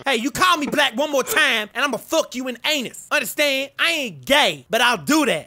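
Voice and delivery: a put-on Kanye voice, brash and cocky; [name] impression. Trash-talking strangers in game chat, brash Kanye voice. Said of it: "My name is [name]".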